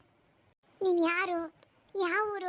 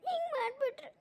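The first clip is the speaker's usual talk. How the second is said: sobbing lament, pitch climbing as it goes; tearful crying; 3 semitones higher than usual. Acted. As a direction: break down crying over the wrong done to you. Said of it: "ಸುಮ್ನಿರವ್ವ, ಅಳಬೇಡ".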